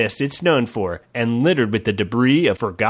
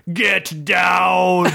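shouted warning; 4 semitones higher than usual. Acted. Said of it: "a voice shouted".

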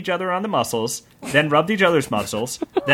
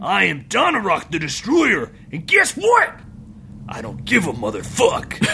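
boastful orc; 4 semitones higher than usual. Acted.

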